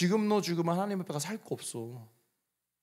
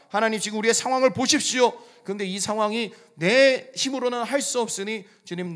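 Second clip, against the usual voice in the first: a shouted prayer of commitment; loud fervent vow; 6 semitones higher, 7 dB louder than usual. Acted. Pastor models a loud vow to the congregation, crying out. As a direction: cry it out loud and high to the Lord. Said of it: "주님께 약속 한번 크게 해보셨으면".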